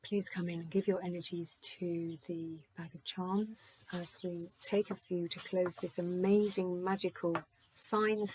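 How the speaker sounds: hushed ritual summons; calling on spirit. Acted. Invocation to spirit and guides.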